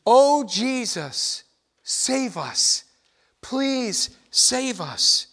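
drawn-out, imploring mock cry; imitated crowd shout. Preacher voices the crowd crying out to Jesus, mock shouting. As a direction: call it out slowly, as a crowd's plea. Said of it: "they're literally shouting out to Jesus".

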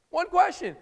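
loud, high, forceful demand; preacher booming Jesus' challenge; emphatic shout. Acted. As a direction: shout it out like a command.

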